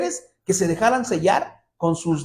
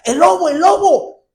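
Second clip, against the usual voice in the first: much higher, loud alarm cry; shouting boy; 12 semitones higher, 5 dB louder than usual. Retold or imitated. Shepherd boy warning the others, high loud shout. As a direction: yell it like a panicked little shepherd.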